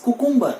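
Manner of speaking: "cucumber" as koo-KOOM-ber; common mispronunciation. Shown as a demonstration. Teacher quoting what people say, cucumber as koo-KOOM-ber. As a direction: say cucumber with OO vowels. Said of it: "it is wrong pronunciation".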